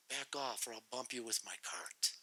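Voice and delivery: soft mock mutter; quiet grumpy cart threat. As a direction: grumble it under your breath. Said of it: "It's like".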